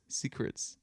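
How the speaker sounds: whispered secretly; whispering. Shown as a demonstration. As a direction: breathe it as a secret. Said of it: "Speak abstractly".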